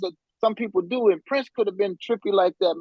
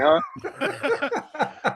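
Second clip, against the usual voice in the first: confused huh; 9 semitones higher than usual. Retold or imitated. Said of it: "I'm like".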